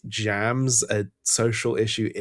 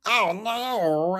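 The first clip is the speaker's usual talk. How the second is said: high, drawn-out cartoon wail; cartoon character voice; 10 semitones higher than usual. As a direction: imitate a cartoon character, vowels stretched high. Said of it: "talks like this".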